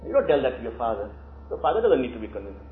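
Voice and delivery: ordinary delivery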